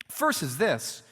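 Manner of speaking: normal talking voice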